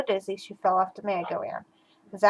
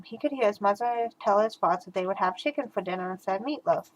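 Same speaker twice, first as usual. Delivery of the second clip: usual speaking voice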